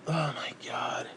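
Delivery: slow, voiceless oh my god; breathless whisper. Real, not acted. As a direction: whisper it, beaten down. Overwhelmed by the memory.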